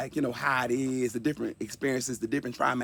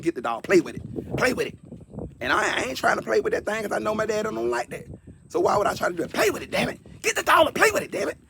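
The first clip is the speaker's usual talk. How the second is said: angry father's orders; 7 semitones higher than usual. Retold or imitated. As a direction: bark at the boy like a furious dad. Father ordering son, escalating.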